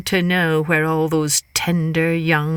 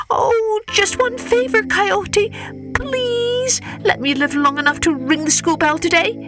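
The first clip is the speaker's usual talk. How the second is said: high, begging plea; pleading Hare; 13 semitones higher than usual. Acted. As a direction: beg for one last favour. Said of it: "clasped his paws together in prayer".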